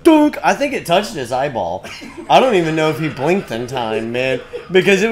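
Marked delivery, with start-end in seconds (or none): 0.0-0.4 high, loud "dunk"; cartoonish loud bonk; imitated hit sound. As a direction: punch out a quick impact noise.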